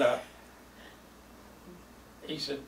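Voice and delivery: usual speaking voice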